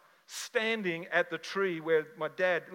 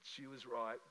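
much softer, awed murmur of realisation; quiet, stunned, as if it just hit him; hushed realisation; 3 semitones lower, 9 dB quieter than usual. Retold or imitated. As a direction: breathe it out as the truth dawns. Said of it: "And I went".

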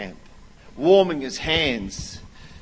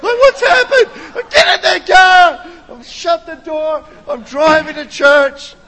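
high, loud frantic retelling; worked-up comic tirade; yelling; 14 semitones higher, 9 dB louder than usual. Retold or imitated. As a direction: keep shouting through the whole panicked account. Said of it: "I'm yelling at my children".

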